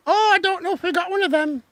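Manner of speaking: high put-on voice; Little Britain character. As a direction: answer in a high silly sketch voice. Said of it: "Little Britain".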